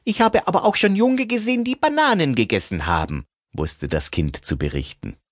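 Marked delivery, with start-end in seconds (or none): 0.0-3.3 put-on high, quick little child voice. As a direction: chatter like an eager small kid. Child character in story.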